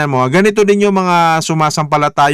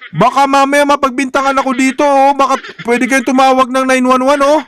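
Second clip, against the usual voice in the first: mock panic; 6 semitones higher than usual. Joking panic to viewers.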